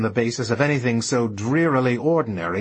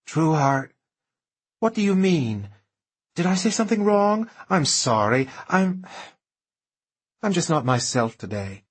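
anxious society lady; 5 semitones higher than usual. Acted. Slim speaks to Truman, anxious.

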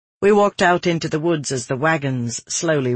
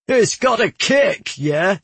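high, drawn-out teasing jibe; mocking, slow call with a questioning tag; jeering guardsman; 6 semitones higher, 4 dB louder, slower than usual. A narrator's reading. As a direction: tease the rookie after her misfire. Men jeering at her misfire.

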